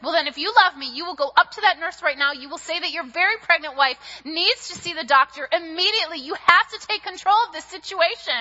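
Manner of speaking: insistent wife telling husband to go to the nurse; bossy demand. Retold or imitated. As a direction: lay down the law to your husband. Demanding husband act, waiting room.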